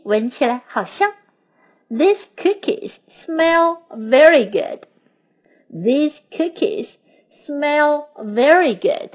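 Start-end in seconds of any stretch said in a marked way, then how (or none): 1.9-9.1 slow, clear teaching read; teacher modelling a sentence slowly; slow model sentence. Shown as a demonstration. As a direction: say it slowly for learners to repeat. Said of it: "Now let's learn some sentences".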